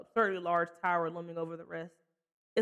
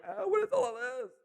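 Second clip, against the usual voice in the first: confused newcomer. Retold or imitated. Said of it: "it'll a hundred percent be like".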